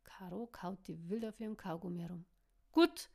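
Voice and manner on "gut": loud, firm "Gut"; short, forceful agreement; robust. A narrator's reading. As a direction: bark it out sturdily. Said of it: "meinte sie robust".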